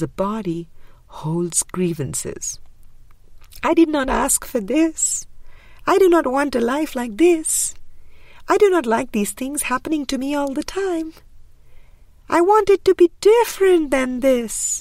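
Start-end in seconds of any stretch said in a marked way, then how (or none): 3.6-14.8 higher, louder aggrieved protest, building up; aggrieved complaint. Retold or imitated. The mind holding grievances protests against its life, loud aggrieved complaint. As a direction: cry out like a victim of life.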